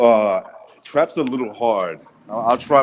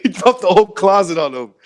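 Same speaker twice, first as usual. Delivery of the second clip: ordinary talk, nothing marked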